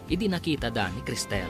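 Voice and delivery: ordinary talk, nothing marked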